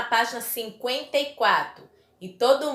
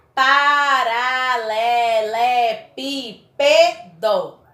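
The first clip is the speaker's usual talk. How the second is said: "paralelepípedo" very slow and loud, every syllable stretched; 6 dB louder, much slower than usual. Shown as a demonstration. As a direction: count out pa-ra-le-le-pí-pe-do for a class. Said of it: "Tem sete sílabas". ("paralelepípedo" bem silabado, lento e forte)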